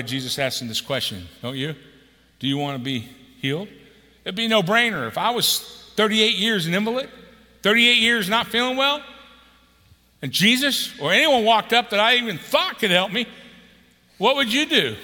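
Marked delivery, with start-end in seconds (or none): 4.2-15.0 loud, high fervent preaching; preaching at full force. Acted. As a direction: drive the point home to the congregation.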